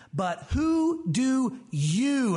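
"who" drawn out slow and weighty; slow emphatic "But who do you?"; stress on who. Shown as a demonstration. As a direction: lean hard on "who", very slow. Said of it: "that word is emphatic".

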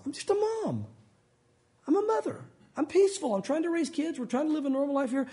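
high-pitched innocent plea; pleading mother. Acted. Mother reasoning with Joab at her besieged city, pleading.

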